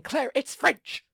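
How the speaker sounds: slow, soothing consolation; consoling. Retold or imitated. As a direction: reassure her gently and slowly. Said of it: "consoles her by saying".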